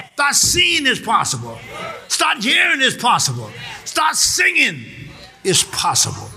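insistent, high-pitched rallying cries; rally the church with rhythmic, driving repeats; rousing preaching. Real, not acted. Rallies the congregation.

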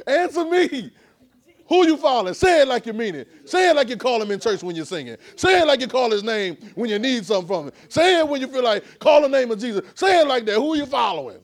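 high, loud, pushing the crowd to answer; loud preaching call. Real, not acted. Preacher calling the congregation to raise hands, loud and high. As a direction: call out to the room and demand hands.

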